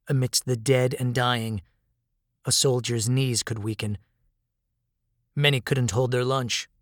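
ordinary delivery, nothing marked

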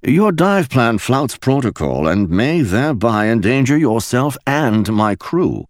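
prim English officer, slowing at the end; clipped lecture from a stiff Royal Navy man; the captain lectures Mickey in a starchy English voice; starchy High Street voice. A narrator's reading. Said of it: "in his starchiest High Street voice".

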